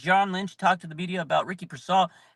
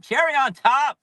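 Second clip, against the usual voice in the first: louder and higher, cherry punched; fan's exclamation repeating himself; excited shout; 8 semitones higher, 6 dB louder than usual. Real, not acted. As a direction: blurt Cherry on top! with a rising cheer. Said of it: "it's a cherry on top".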